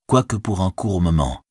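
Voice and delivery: ordinary delivery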